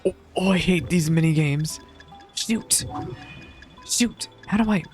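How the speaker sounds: ordinary talk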